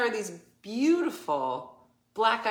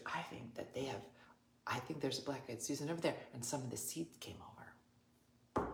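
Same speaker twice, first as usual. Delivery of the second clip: hushed sheepish excuse; 6 semitones lower, 16 dB quieter than usual. Retold or imitated.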